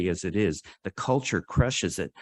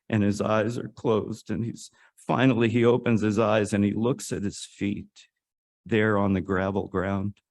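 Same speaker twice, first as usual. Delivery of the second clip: usual speaking voice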